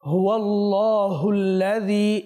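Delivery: হুয়াল্লাহুল্লাযী slow, with a full, clear ha. Shown as a demonstration. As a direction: draw out হুয়াল্লাহুল্লাযী, no swallowed letters. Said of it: "তো এগুলো সুস্পষ্ট হতে হবে।".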